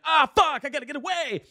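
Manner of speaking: high, frantic mock panic, fading; hammed-up urge to flee. Retold dream, fleeing the demon.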